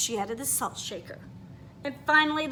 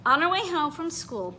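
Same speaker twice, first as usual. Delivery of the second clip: usual speaking voice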